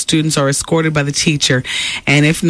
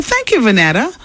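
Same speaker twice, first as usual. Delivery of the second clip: ordinary talk, nothing marked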